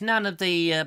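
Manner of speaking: normal talking voice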